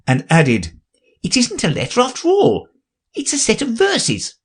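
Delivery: high, hurried little rabbit voice. A narrator's reading. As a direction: fuss in like a nervous courtier.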